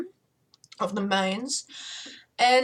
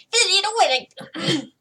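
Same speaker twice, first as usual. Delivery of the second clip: much higher, tiny squeak; squeaky little voice; 8 semitones higher, 4 dB louder, much faster than usual. Shown as a demonstration. Trying the little coven member, squeaky voice. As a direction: attempt a small squeaky character. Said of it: "squeaky little voice".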